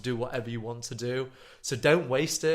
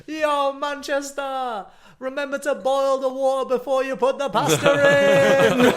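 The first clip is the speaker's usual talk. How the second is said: high-pitched mock public announcement, building louder; calling out; 11 semitones higher, 7 dB louder, slower than usual. Podcaster hailing Manchester with silly advice, high calling-out voice. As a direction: holler it to the whole city, stretching the greeting. Acting out slogans called to Manchester.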